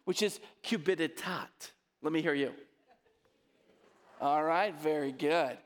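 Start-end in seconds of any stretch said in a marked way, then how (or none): 0.6-1.7 "cupiditat" ending in an open "taht"; Latin pronunciation. Shown as a demonstration. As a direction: model "cupiditat" as Latin, last syllable "taht". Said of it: "comes from a Latin word".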